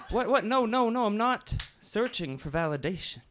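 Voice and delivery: ordinary delivery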